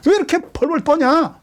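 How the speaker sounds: high, urgent pleading to his own soul. Retold or imitated. David scolding his trembling soul, high urgent plea. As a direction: beg your own soul to look to God. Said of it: "자기에게 그래".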